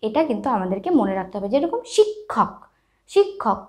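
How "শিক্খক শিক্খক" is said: শিক্ষক slowly, k then kh; শিক্ষক pronounced shik-khok. Shown as a demonstration. Teacher giving pupils an example, শিক্ষক as shik-khok. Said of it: "ক আর খ এর একটা উচ্চারণ আসছে".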